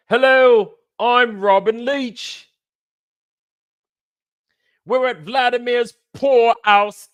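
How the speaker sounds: high, loud British TV host; hammed-up impersonation of a projecting celebrity-show presenter; Robin Leach. Host welcomes viewers to Vladimir's poor house, hammed-up showy announcing. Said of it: "Oh, yeah, Robin Leach".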